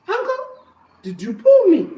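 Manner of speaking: high little rabbit asking innocently; high clever rabbit voice; rabbit's voice. Acted.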